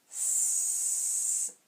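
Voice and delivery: "S-ad" stretched into separate sounds; segmented slowly. Shown as a demonstration. Teacher models "sad" slowly for students, sound by sound. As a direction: pull "S-ad" apart slowly, sound by sound. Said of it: "Model how to say it slowly".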